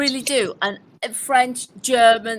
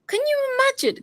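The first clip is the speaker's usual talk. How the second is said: pitched way up in disbelief; excited high exclaim. Real, not acted. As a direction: squeal the question. Excited rhetorical question.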